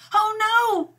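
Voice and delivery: high, dismayed wail. A narrator's reading. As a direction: cry out in dismay at purple ears. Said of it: "wailed Zelda".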